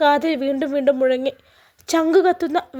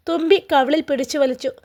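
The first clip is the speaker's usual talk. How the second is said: plain reading voice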